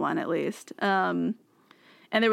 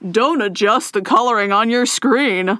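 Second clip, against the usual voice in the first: loud, over eager TV pitchman; infomercial voice; 4 semitones higher, 8 dB louder than usual. Retold or imitated. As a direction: sell it like a late-night ad. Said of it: "That's why I use infomercial voice".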